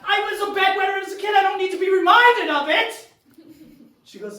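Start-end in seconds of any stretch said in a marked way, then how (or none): 0.0-3.1 high, loud and fast, indignant; fast angry retort. Retold or imitated. Patient snapping at the nurse about his past, fast loud indignant. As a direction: snap back hotly in mock offense.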